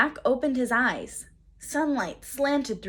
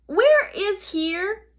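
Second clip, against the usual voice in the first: slow, high, loud question; calling down; 11 semitones higher, 6 dB louder, much slower than usual. A narrator's reading. Girl calling down to the pteranodon. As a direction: call it down slowly so it carries. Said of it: "Annie called down".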